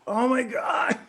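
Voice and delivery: high excited exclamation; delighted squeal. Real, not acted. Player reacting to the boy and wolf sharing eyes, high and delighted. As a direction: gush over the cuteness.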